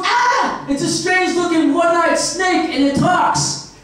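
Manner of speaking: high yelp easing into mock amazement; hammed-up high-pitched astonishment; shocked Eve. Eve discovering a talking snake, in mock high-pitched shock.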